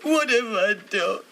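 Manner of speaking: crying out through tears; tearful despair. Acted. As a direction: wail it in anguished regret. Breaks down over her confession.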